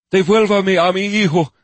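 high, loud pleading cry; desperate plea. Acted. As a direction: beg for your son in despair. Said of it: "his tone a plea thick with despair".

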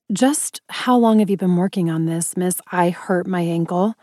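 usual speaking voice

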